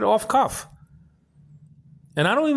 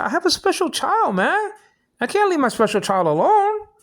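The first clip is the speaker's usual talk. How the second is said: higher, insistent retelling of his own words; pitching it up to make the point; raised retold protest; 9 semitones higher than usual. To a neighbor asking why he stays home, a higher insistent protest.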